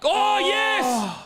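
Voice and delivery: high, thrilled shout of joy; excited cheer. Real, not acted. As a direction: cheer like your team just scored. Celebrating a try live.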